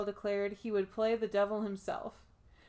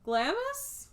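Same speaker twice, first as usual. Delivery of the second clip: high, questioning rise; questioning guess; 12 semitones higher than usual. Real, not acted. Host guessing how the castle is said, rising question. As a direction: venture it as an unsure guess. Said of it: "So I'm gonna say it's".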